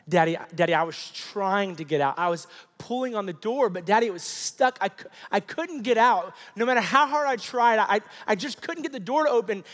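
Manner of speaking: put-on fearful child's pleading. Son explaining he was trapped, in mounting distress. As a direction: plead in a rising, urgent child's voice. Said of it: "through his tears and his fear".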